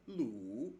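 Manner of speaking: Lu dipping down then rising; dipping third tone. Shown as a demonstration. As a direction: Lu in a Mandarin third tone. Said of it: "the third tone would be".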